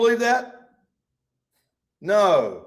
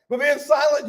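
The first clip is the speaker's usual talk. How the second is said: shout; 7 semitones higher, 3 dB louder than usual. Acted. Voicing Job's anguish.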